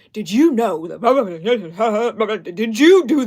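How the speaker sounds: loud, overblown clickbait narrator; dramatic narrator voice. Retold or imitated. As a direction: ham it up like a cheesy short. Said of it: "like, very dramatic".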